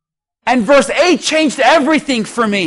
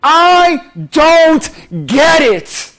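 raised, slow, drawn-out complaint to God; exasperated plea; 4 semitones higher, 4 dB louder, much slower than usual. Retold or imitated. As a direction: drag out the frustration, louder. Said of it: "And you're just saying".

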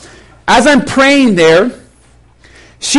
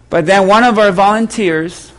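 usual speaking voice

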